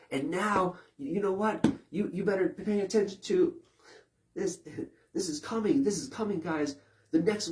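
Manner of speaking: high, loud breaking-news hype; hammed-up TV anchor warning of the next wave; mock alarmist newscaster. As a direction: grab them with urgent doom. Imitates newscaster hyping danger.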